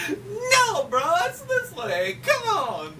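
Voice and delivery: very high, insistent cartoon squeal; Mario. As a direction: squeal a playful correction to a brother. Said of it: "Mario's like".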